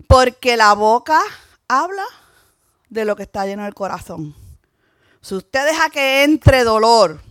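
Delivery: loud, emphatic sermon delivery; preaching. Acted. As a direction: preach it with force, punching key phrases. Preaches to the congregation. (predicación enérgica y enfática)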